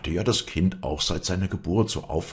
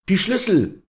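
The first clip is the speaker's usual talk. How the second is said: high, loud burst of anger; furious cry; 9 dB louder than usual. Acted. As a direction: explode in anger, demanding. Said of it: "schrie Walli in ausbrechendem Zorn". (zorniger Aufschrei, hoch und laut)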